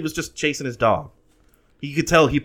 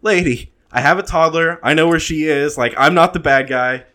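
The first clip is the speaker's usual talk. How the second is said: fed up; 4 semitones higher, 6 dB louder than usual. Retold or imitated. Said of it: "And I was like".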